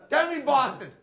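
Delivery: high, loud prompt in an Indian accent. Shown as a demonstration. Teacher prompts the class to repeat, loud Indian accent. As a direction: push "Boston" out with an Indian accent. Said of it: "how to do an Indian accent".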